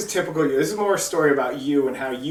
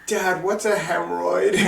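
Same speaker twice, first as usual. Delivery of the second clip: laughing while quoting; 6 semitones higher, 3 dB louder than usual. Retold or imitated. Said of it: "catches her breath enough to say".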